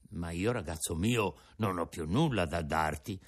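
put-on an old father offering his breakfast; old Geppetto. Geppetto has nothing left.

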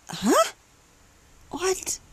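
high, baffled "huh? what?"; mock disbelief, voice shooting up; incredulous huh what. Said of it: "I'm like".

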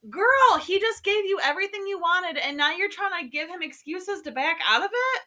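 high, loud incredulous scold; exasperated scolding. Real, not acted. Reader scolding the heroine, exasperated. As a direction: talk sense into her, fed up.

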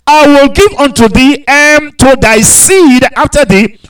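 lifted pulpit reading voice; scripture reading. Acted. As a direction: proclaim the verses to the congregation. Reads verse eight.

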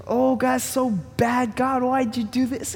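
hammed-up whiny prayer; mock complaining. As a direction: moan it out, feeling sorry for yourself. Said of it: "and you just go".

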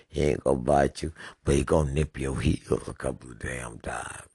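ordinary talk, nothing marked

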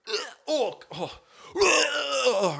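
high, loud gagging moans; high retching groans. Retold or imitated. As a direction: groan like dry heaving. Dry heaving.

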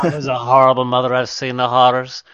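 hammed-up gravel of a war-weary Brando; Marlon Brando impression. Mom's guilt redone as Brando in Apocalypse Now. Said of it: "sounded like Marlon Brando from Apocalypse Now".

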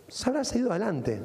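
ordinary talk

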